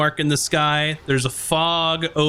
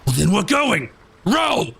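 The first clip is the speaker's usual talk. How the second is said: high barked command, Row! soaring; ordering them to row; 7 semitones higher than usual. Acted. Bosun Karzak ordering his crew to row, rising to a high yell.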